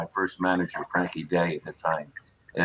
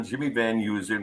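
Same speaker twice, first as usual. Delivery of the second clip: ordinary delivery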